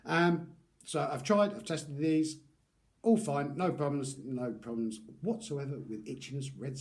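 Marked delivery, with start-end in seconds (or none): none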